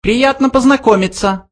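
very high, small creature voice; mouse voice. A narrator's reading. Second mouse greets a new acquaintance, high mouse voice. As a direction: pitch it right up like a mouse. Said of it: "сказал другой мышь".

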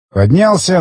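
ordinary delivery, nothing marked

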